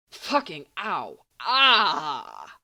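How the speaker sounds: a pained curse, then a sharp loud yelp; stronger pained cry. A narrator's reading. Sirius waking hurt, then jolting his thigh: slow pained curse into a loud yelp. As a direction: swear slowly through the pain, then cry out. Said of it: "a little stronger".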